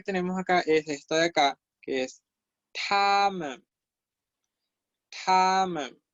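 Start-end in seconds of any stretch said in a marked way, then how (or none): 2.9-3.7 "tamen" with a puff of air on the t; high held "ta", then a short falling "men"; aspirated T. Shown as a demonstration. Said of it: "estoy expulsando aire acá en la T". 5.2-6.0 "Tamen" with air pushed through the T; long high first syllable, light falling second in "Tamen"; aspirated T. Shown as a demonstration. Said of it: "estoy expulsando aire acá en la T".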